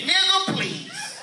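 very high-pitched imitation; mock a lady's piping, drawn-out retort.

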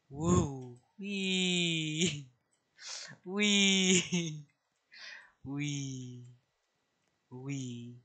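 high playful squeals of fun; delighted wee while flying around; gleeful whee. Real, not acted. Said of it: "Why is this so fun?".

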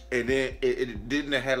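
ordinary talk

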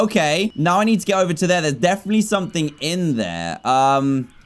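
normal talking voice

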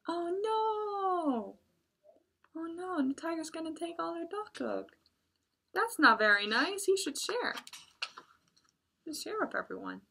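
put-on high, squeaky child voice; baby animals complaining the tiger is greedy; upset little animals. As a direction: pipe up like a small worried animal.

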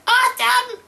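very high delighted squeal; excited squeal. Real, not acted. Finds a new voxophone.

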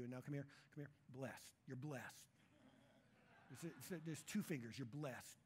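casual low patter of an offering pitch; mock prosperity preacher. Pretending to be a prosperity preacher taking the offering, mock preacher patter. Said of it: "I say this sort of pretending".

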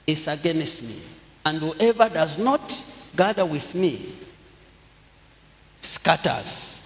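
usual speaking voice

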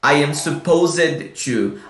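slow "supposed" in three syllables, suh-POH-zed; wrong -ed ending. Shown as a demonstration. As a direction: mispronounce "supposed" with a full "zed" at the end. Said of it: "a gente nunca vai falar".

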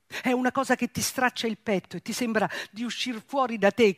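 ordinary delivery

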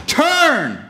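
high, loud shout of warning; urgent warning. Acted. Wisdom warns a driver about to go off the cliff, urgent shout. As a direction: yell it like a last-second alarm. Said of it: "wisdom's saying".

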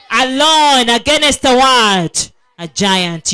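ordinary talk

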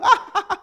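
high, loud mocking cackle; mocking devil laugh. Demon mocks a fallen believer with a high cackle.

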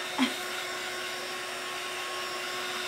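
airy, high giggle with no voice; breathy laugh. Real, not acted. Vlogger on a live stream, laughing at her own buggy mix-up. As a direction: let out a silent, squeaky laugh.

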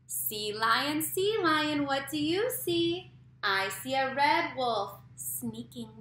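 slow, loud sing-song read-aloud; reading to a toddler. A narrator's reading. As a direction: read it slow and bouncy for little kids. Reads picture book aloud to kids.

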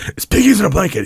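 high, loud taunt; teasing shout. Retold or imitated. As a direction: taunt your little brother loudly. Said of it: "I'll be like".

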